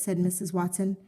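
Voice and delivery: ordinary delivery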